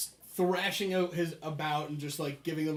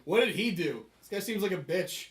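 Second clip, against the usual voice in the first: higher, louder dismissive jab; scornful prisoner; 4 semitones higher, 6 dB louder than usual. Acted. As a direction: sneer about the newcomer to the guard. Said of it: "he looks to the guard".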